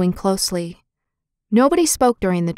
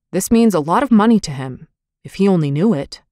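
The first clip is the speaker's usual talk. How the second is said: mildly impatient, leaning on the key words; a touch of impatience; 4 dB louder than usual. A narrator's reading. Visitor waiting for the doctor, mildly impatient. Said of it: "said the visitor impatiently".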